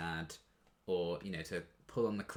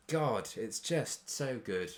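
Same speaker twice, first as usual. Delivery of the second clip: slow gushing; 4 semitones higher, much slower than usual. Real, not acted. Gushing over the show.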